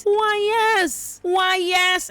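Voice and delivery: very high, held-out mock answer; mock affirmation. Host answering Alicent's question about loyalty, mocking. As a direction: sail up high and hold each word.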